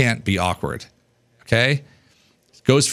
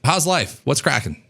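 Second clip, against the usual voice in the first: much higher, energetic hello; host greeting guests with high energy; upbeat greeting; 8 semitones higher than usual. Shown as a demonstration. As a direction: throw your energy into the hello. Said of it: "I'll be like".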